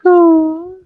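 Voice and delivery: long, high cooing oh; high, held coo of delight; touched aww. Retold or imitated. Mother touched by being called mom.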